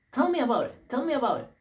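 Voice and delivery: high, scornful tell me about it; sarcastic retort. Real, not acted. Ranting vlogger scoffing at complainers, high sarcastic scorn. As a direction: throw it back with scorn.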